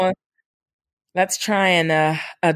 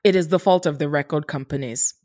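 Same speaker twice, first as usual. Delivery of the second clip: normal talking voice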